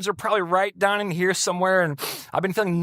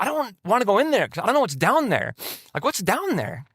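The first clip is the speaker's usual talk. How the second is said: higher-pitched, mildly anxious protest; retold dread; 6 semitones higher than usual. Man telling his counselor he fears the abyss, mildly anxious. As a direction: plead lightly against going down there.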